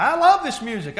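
high, loud imitated driver; mock holler over a blaring stereo; shouted retold reply. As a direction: yell it happily over the music.

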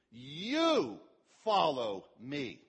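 YOU punched loud, then follow me slow; emphatic you. Shown as a demonstration. Preacher stressing You to the congregation, loud and slow. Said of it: "Emphatic you".

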